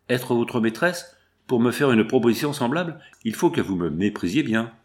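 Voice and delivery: plain reading voice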